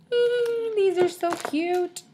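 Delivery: high squeal of delight. Real, not acted. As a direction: squeal at something cute.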